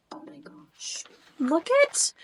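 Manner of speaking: breathless whispered wonder; awed whisper. Real, not acted. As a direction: gasp it at something beautiful. Gasp at the artwork.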